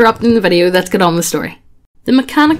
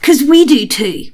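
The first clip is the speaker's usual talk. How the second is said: ad read voice; 5 semitones higher than usual. Acted. Hammed-up sponsor ad read.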